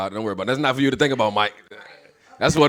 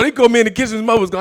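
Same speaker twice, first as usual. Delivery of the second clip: usual speaking voice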